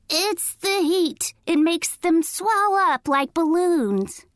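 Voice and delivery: high fake granny voice; high-pitched granny imitation. A narrator's reading. Coyote explaining his big feet, fake granny voice. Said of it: "in a high-pitched voice".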